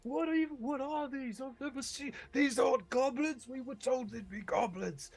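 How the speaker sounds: very high, frightened babble; panicked dwarf. Acted. Scared dwarf facing what were meant to be goblins, high panicked cry. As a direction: squeal it out in bewildered panic. Game master voices panicking dwarves.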